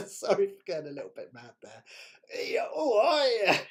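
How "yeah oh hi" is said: a higher, hammed-up Cockney hello; playful Cockney hi; mock cockney greeting. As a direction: greet them like a London market trader. Launching into a cockney bit.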